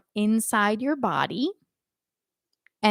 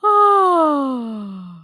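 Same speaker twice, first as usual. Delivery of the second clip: loud open sigh on oh; 5 semitones higher, 5 dB louder than usual. Shown as a demonstration. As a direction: sigh it out with a wide open throat. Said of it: "if you're sighing".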